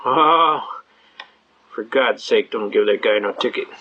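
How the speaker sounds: low-pitched, weary cop voice; exasperated policeman. Retold or imitated. Policeman pleading over the radio to skip the ticket, exasperated. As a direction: drop low like a fed-up officer on the radio.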